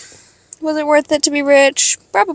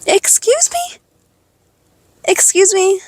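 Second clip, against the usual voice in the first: very high, scandalised excuse me; indignant excuse me; 6 semitones higher than usual. Retold or imitated. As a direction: play the offended retort. Reacting to stepmom's insult.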